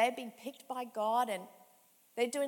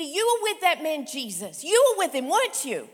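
accusing; 4 semitones higher, 11 dB louder than usual. Acted. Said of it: "accuses Peter".

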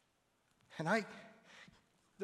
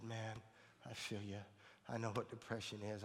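gentle comfort; 5 semitones lower, 8 dB quieter than usual. Retold or imitated. Comforting her about depression.

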